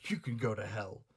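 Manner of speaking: usual speaking voice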